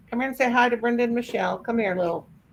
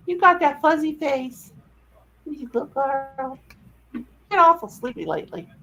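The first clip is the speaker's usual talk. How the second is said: pet talk; 5 semitones higher than usual. Real, not acted. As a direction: tease the sleepy puppy. Talking to dog.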